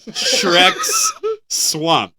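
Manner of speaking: laughing through the words; giggling while reading the name. Real, not acted. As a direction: breaks into a laugh mid-title. Laughing at the headline.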